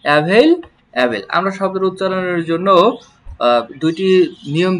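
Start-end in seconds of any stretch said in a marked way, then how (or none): 0.0-1.2 available stretched out slowly, each syllable clear; slow teacher demo; slow model word. Shown as a demonstration. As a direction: spell it out in sound for the class. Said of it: "teacher models the word".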